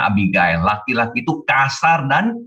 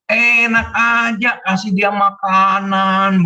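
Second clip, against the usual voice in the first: stingy Nabal; 6 semitones higher, slower than usual. Acted. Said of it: "Nabal bilang begini".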